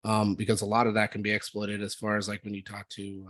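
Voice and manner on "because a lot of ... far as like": normal talking voice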